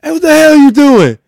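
high, loud imitated yell; mock yelling bystander. Friend retelling the man who yelled at the humping dog.

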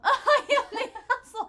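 high, loud, laughing outburst; laughing mock admission. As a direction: crow it through laughter. Mockingly echoes friend's confession, laughing.

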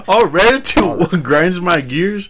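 teasing. Acted. Teasing friend about ranting.

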